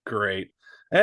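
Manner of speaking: normal talking voice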